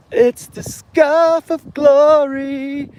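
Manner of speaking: high, slow grand proclamation; mock epic. Vlogger hailing a found scarf in a high, slow epic voice. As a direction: crown it like a trophy.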